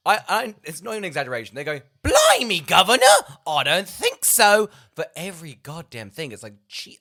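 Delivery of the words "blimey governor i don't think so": loud, high, hammed-up Cockney child; Cockney kid. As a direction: shout it like a cartoon Cockney urchin. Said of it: "the most cockney children in the world".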